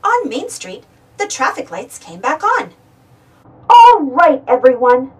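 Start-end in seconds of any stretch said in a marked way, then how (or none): 3.7-5.1 loud announcement through a megaphone. Acted. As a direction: call out to the crowd by megaphone. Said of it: "Chase said in his megaphone".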